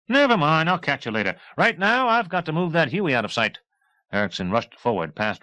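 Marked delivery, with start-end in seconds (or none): 0.1-3.6 much higher, louder breezy chatter; jaunty talkative flyboy; high, loud brash pilot; cheerful pilot. A narrator's reading. Erickson voice continues.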